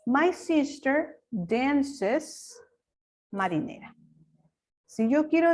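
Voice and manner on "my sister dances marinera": very slow, word by word, for the class; slow dictation pace; slow model sentence. Shown as a demonstration. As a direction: spell the sentence out slowly like a teacher. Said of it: "Entonces yo digo".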